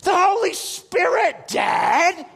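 put-on loud, high, slow kid voice; insistent son. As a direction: imitate a boy spelling it out for a slow dad. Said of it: "he goes".

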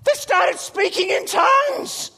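high, loud, drawn-out amazement; hammed-up thrilled kid; excited son. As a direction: imitate a boy shouting astonishing news. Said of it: "He goes".

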